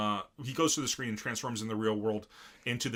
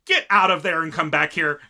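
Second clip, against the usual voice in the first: booming command; loud order; 5 semitones higher, 13 dB louder than usual. Retold or imitated. Caliber ordering team home, loud booming command. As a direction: bark it down the phone.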